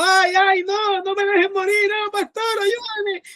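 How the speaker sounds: very high, imitated wail of despair; desperate cry. Dying boy begging his pastor not to let him die, desperate cry. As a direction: plead frantically in a high, drawn-out voice. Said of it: "él gritaba desesperado".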